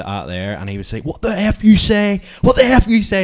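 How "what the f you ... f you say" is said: retold scream. Drunk guy screaming across the shop at the speaker, high and loud. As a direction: bellow it like a man losing his head. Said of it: "he was just screaming across the shop at me".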